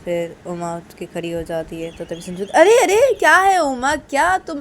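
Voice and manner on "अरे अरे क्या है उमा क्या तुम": high, loud startled protest; acted sudden dismay; alarmed objection. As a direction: call out to stop her leaving. Alarmed as Uma gets up.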